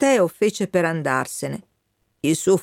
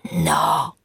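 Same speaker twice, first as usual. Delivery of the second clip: low, breathy and spent; a faint, dropped refusal; weak refusal; 12 semitones lower than usual. Acted. Dying colonel turns down the Wind's company, weakly.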